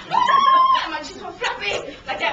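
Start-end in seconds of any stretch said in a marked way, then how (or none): 0.1-0.8 high, loud shriek; acted terrified scream. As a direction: scream as if falling from a bridge. Screaming during bungee fall.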